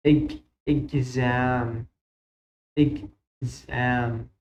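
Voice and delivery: slow إجزام with a clear z sound; slow careful model. Shown as a demonstration. معلم ينطق إجزام ببطء وبوضوح لطلابه. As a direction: spell out إجزام syllable by syllable. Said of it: "مو إجسام".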